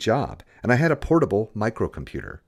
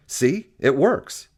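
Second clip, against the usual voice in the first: proud triumph; 6 semitones higher, 4 dB louder, much slower than usual. A narrator's reading. Tech shows it works.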